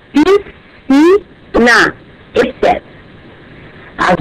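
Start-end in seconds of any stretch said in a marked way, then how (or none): 0.1-2.0 "piscina" spelt out slowly as pis-ci-na; slow syllable split. Shown as a demonstration. ("piscina" bem devagar, por sílabas)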